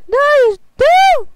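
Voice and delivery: high, loud, slow outraged yell. Real, not acted. Kid fed up with the parkour level, outraged yell. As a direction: yell it at the game in disgust.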